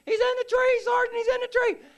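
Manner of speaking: screechy high, loud warning; very high, full-volume shout; panicked yelling. Retold or imitated. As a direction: imitate a frantic soldier yelling out a sighting. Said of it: "Floyd starts yelling".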